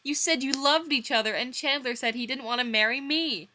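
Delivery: high accusing outburst; angry. Acted. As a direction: accuse them loudly. Monica continuing angry.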